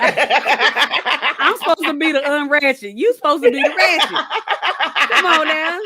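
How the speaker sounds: laughing it out slowly; laughing while teasing her cohost. Real, not acted. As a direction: giggle through the tease. Laughing teasing about roles.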